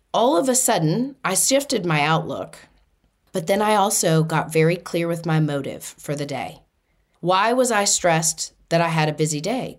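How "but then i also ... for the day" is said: ordinary delivery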